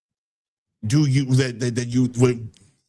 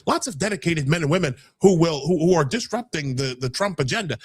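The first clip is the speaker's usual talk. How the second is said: usual speaking voice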